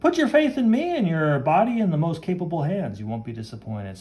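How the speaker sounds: mildly grand, confident promise; grand fashion friend. Acted. Fashion friend reassures her client, lightly grand.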